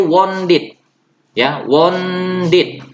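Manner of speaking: "wounded" lambat, E dibaca I: /wondit/; E pronounced. Shown as a demonstration. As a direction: ucapkan "wounded" dengan E terdengar. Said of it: "huruf E-nya dibaca". (E dalam "wounded" dibaca)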